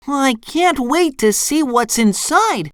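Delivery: high, eager cartoon voice; SpongeBob calling out. A narrator's reading. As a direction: exclaim excitedly in a high voice. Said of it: "SpongeBob shouted".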